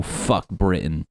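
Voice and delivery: usual speaking voice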